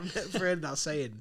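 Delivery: usual speaking voice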